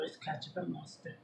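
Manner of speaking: usual speaking voice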